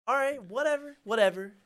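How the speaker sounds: loud, high brush-off; defiant dismissal. Retold or imitated. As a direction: throw up a loud "fine, have it your way". Giving up argument with cops.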